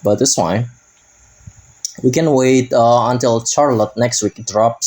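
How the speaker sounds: ordinary delivery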